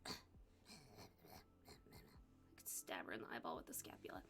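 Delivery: low conspiratorial whisper; whispered aside. Real, not acted. Telling listeners her secret wish, a low whisper. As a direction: whisper the dark joke. Private dark joke aside.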